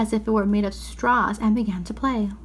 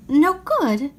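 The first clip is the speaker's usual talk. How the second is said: high, alarmed echo of the words; startled and a little scared; frightened; 5 semitones higher, 4 dB louder than usual. Acted. Lucy frightened by the faun's warning, high and alarmed. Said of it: "feeling rather frightened".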